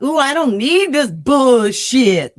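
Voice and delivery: sassy audition read, drawn out. Retold or imitated. Actress reading the script as casting wanted, sassy and drawn out. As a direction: lean slowly and loosely into the scripted line. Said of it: "I read it the way they wanted to".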